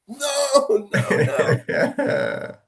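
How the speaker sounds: high mock wail of protest; hammed-up pleading, starting high and stretched; imitated kid's despair. Coach imitating a kid dreading the event, mock despair.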